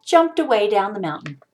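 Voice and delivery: usual speaking voice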